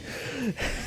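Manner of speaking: quiet, breath-only laugh; stifled laugh. Real, not acted. Storyteller recalling hiding from the teacher, soft stifled laugh. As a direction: let out a soft, voiceless chuckle.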